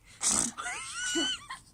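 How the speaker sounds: squealing laugh; high burst of laughter. Real, not acted. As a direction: break into a high shriek of laughter.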